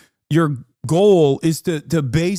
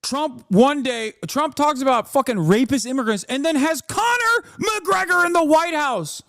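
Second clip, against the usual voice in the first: pitched up, incredulous rant; heated high rant; 11 semitones higher than usual. Real, not acted. Streamer ranting about Trump, high and heated. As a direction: rail at it with rising outrage.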